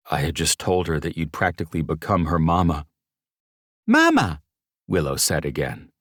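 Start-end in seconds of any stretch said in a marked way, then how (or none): 3.9-4.4 put-on high, loud baby squeal; excited baby shout. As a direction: squeal the word like a delighted toddler.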